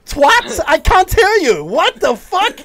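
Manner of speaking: can't believe the number; shocked disbelief. Real, not acted. Shocked at the fine.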